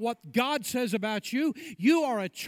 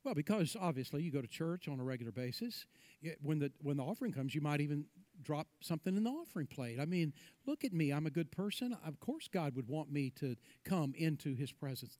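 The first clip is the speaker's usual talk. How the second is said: low, offhand, much softer mock excuse; lightly voiced self-satisfied churchgoer; mock self-justifying churchgoer; 5 semitones lower, 8 dB quieter, faster than usual. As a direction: murmur a smug excuse, lightly acted.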